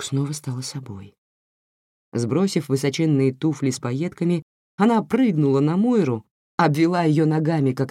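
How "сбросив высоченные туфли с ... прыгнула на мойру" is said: ordinary delivery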